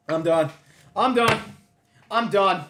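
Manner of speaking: loud, high, stunned "I'm done"; stunned outburst. Real, not acted. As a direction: throw your hands up, high and loud. Shocked by the statistics.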